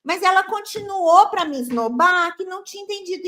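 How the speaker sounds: ordinary talk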